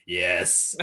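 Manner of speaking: low, gravelly imitation; mock giant voice, dropped low and rough; [name]. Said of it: "like, gravelly. It doesn't sound like [name]".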